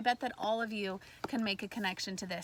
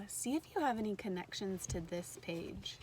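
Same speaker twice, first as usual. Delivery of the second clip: ordinary talk, nothing marked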